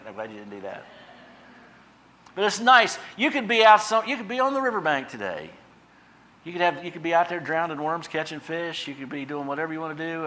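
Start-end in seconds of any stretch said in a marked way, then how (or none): 2.4-10.2 higher, louder fervent preaching. Acted. Preacher urging the congregation toward joy, higher fervent preaching. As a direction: lift it up like a revival preacher.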